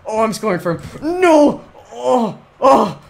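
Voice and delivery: loud, very high cries; frantic shouting. Real, not acted. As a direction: yell out in disbelief at the play. Nearly scores for opponent.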